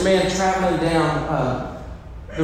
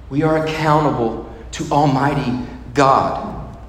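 ordinary delivery

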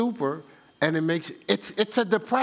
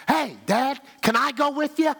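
put-on eager little kid; 8 semitones higher than usual. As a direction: play the eager son tugging at Dad. Child voiced asking dad.

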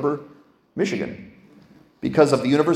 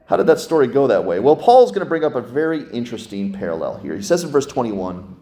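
usual speaking voice

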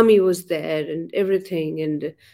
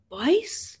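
high, startled "बाईस?" rising; surprised; 8 semitones higher, 4 dB quieter than usual. Retold or imitated. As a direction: echo it in disbelief. Retold surprised echo.

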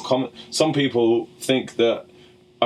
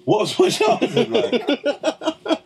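incredulous echo; 14 semitones higher, much slower than usual. Real, not acted. Echoing an odd question in disbelief.

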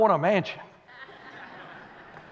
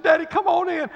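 put-on child's voice; 9 semitones higher, 6 dB louder than usual.

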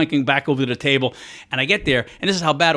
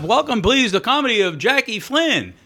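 higher, slow announcer's welcome; a hint of a cheesy emcee; mock emcee intro; 8 semitones higher, slower than usual. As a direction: lay on the showbiz introduction. Retold emcee introducing him.

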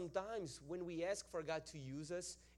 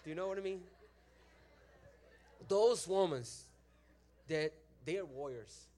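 normal talking voice

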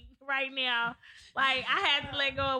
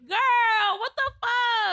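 higher and louder outraged yell; really mad; 10 semitones higher, 6 dB louder, much slower than usual. Retold or imitated. Retelling to friends how she yelled at her best friend, high outraged yell. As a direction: shriek it at the friend who used her toothbrush. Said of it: "I was really mad".

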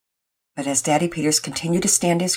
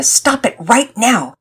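raised, emphatic angry order; angry outburst; 7 semitones higher, 6 dB louder, slower than usual. A narrator's reading. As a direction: punch each word like a near-yell. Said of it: "before she could yell out".

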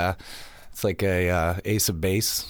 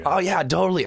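higher, put-on agreement; lightly acted phone chatter; fake phone call; 6 semitones higher than usual. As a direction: pretend someone is on the line. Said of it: "pretended to be on the phone".

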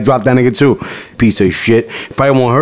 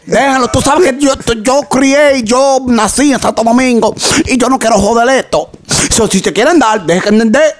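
imitating his Dominican mom; 8 semitones higher than usual. Friend retelling how his mom ignored the teacher hitting him, mock scolding mother voice. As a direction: ham up an exasperated immigrant mom telling her son to take it. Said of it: "She was like".

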